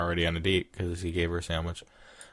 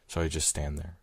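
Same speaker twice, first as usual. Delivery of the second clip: ordinary delivery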